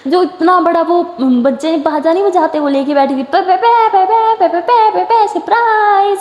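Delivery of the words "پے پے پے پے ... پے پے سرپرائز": high, loud tooting pe-pe-pe then a slow drawn-out surprise; toy horn honks. Retold or imitated. Cousin hiding in the car trunk blows a horn, high loud toots. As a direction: blare it like a kid's party horn.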